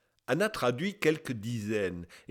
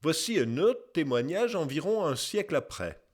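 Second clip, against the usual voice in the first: usual speaking voice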